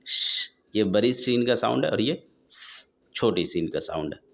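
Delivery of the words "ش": normal talking voice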